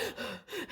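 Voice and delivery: high, quiet gasp for air; breathless gasp. Retold or imitated. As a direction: gasp as if winded from jogging. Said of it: "I'm, like, out of breath".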